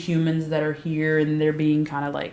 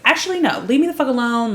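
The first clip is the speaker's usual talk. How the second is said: high, loud brush-off; sharp breakup; 7 semitones higher, 4 dB louder, faster than usual. Retold or imitated. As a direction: snap it at him and leave. Sookie breaks up with Bill.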